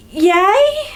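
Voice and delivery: high, questioning little cheer; hammed-up uncertain yay, rising; unsure cheer. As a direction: celebrate while clearly in doubt. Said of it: "someone's internal voice being like".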